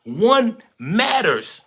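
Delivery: slow, drawn out, voice cracking with feeling; impassioned. Real, not acted. Pleading with viewers, slow cracking impassioned voice. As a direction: lean hard into every word. Preaching unity on a livestream.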